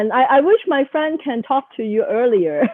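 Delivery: laughing through the words. Real, not acted. Guest laughing about the friend's dumb purchase. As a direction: speak while breaking into real laughter.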